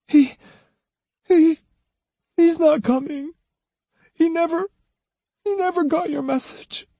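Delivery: very high, halting weeping voice; broken, stammering, high-pitched crying; tearful sobbing. A narrator's reading. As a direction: choke the words out through acted tears. Akemi answers while weeping.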